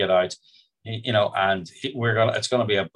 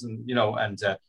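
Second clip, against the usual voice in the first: usual speaking voice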